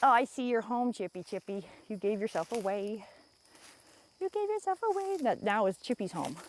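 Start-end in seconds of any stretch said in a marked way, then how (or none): 4.2-5.2 sing-song tease, pitched up high; high teasing coo. Real, not acted. Vlogger teasing chipmunk about its home, high sing-song.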